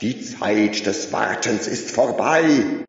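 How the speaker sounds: high, loud, ringing proclamation; loud crowd address. A narrator's reading. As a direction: whip up the crowd from the podium. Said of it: "rief er".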